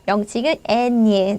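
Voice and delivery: normal talking voice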